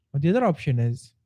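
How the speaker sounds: usual speaking voice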